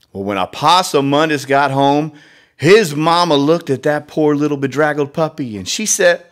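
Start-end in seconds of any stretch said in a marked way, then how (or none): none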